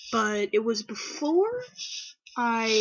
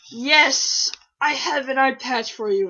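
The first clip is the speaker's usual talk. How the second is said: loud, higher-pitched imitation of a thrilled friend; mildly imitated excitement; 3 semitones higher, 8 dB louder than usual. Friend reacting to the quiz result, imitated excitement. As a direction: burst out with a mock-delighted yes. Acting out friend's delighted reply.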